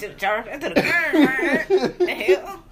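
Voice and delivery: laughing hard through the words; loud laughing exclamation. Real, not acted. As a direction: crack up while saying it. Mocking laugh at the scene.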